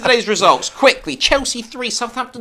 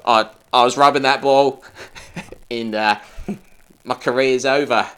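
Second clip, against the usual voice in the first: put-on Australian accent; 8 semitones lower than usual. Lamenting his career, low Australian accent. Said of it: "on the Australian".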